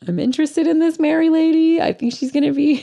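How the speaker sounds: high mock Ellen, intrigued. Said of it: "She's like".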